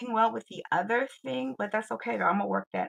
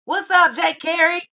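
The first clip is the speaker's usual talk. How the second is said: excited greeting; 9 semitones higher, 11 dB louder than usual. Real, not acted. Greeting a viewer joining.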